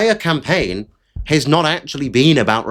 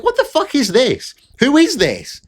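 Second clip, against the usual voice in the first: very high, baffled mock outrage; exasperated disbelief pitched way up; incredulous; 11 semitones higher than usual. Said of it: "I'm like".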